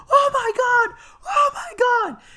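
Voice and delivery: high, loud cry of alarm; mock horrified scream. Somebody sees the boy dangling, mock horrified scream. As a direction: shriek as an onlooker in panic.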